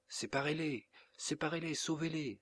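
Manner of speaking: high-pitched urgent plea; crying out. A narrator's reading. Daughter begs parents to stop the duel, crying out. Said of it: "s'écria Isidora".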